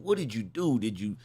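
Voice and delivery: ordinary talk, nothing marked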